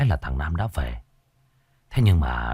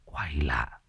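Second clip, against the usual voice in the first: softly whispered wonder; 4 semitones lower, 9 dB quieter, slower than usual. A narrator's reading. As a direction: breathe it to yourself. Thiết muttering alone.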